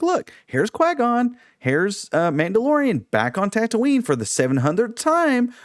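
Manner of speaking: high, hammed-up voice dangling keys at kids; mocking Star Wars. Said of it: "dangling shiny keys, going".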